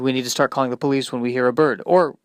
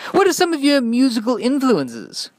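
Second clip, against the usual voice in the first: radio DJ; 10 semitones higher than usual. Acted. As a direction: pump up the show like a cheesy DJ.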